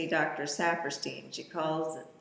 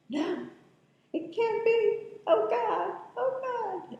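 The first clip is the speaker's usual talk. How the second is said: anguished disbelief. Retold or imitated. Voicing Mia in disbelief.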